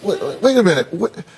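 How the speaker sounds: high-pitched, disbelieving halt; real disbelief, voice pitched well up; incredulous interruption. Real, not acted. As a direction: break in with startled whoas, then challenge. Host halts her, challenging the claim.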